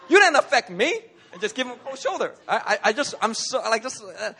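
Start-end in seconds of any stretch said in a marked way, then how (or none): 0.1-1.0 higher and louder, through a laugh; mock tough shrug-off; laughing mock defiance. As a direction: act unbothered, laughing. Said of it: "I'm be like".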